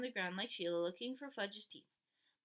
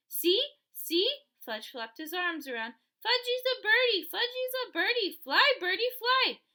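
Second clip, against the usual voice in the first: put-on high, loud, gleeful child voice; loud high toddler cheer; excited toddler; 10 semitones higher, 9 dB louder than usual. A narrator's reading. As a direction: shout like a kid who thinks he can fly. Toddler flapping on gym.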